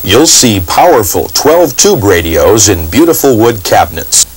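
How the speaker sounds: ordinary talk, nothing marked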